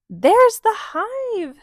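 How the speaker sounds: high, loud and slow bee shout; the bee cries out the find; excited cry. Acted. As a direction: call it out as you spot the hive. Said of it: "Phoebe cried".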